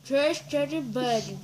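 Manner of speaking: cheeks stuffed with marshmallows; mumbled through a full mouth. Real, not acted.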